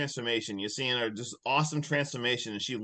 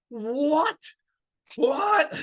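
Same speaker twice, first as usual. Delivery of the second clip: high-pitched incredulous cry; imitated high yell; 13 semitones higher than usual. Mimicking his reaction after killing her, imitated high yell. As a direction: squeal it in comic disbelief. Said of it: "Then he just yells".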